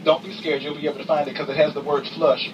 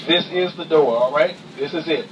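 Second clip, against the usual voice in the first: ordinary delivery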